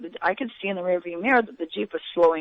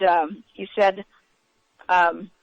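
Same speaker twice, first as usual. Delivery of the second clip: ordinary talk, nothing marked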